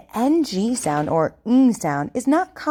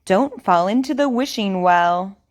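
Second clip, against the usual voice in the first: over enunciating. Shown as a demonstration. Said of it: "Repeat after me".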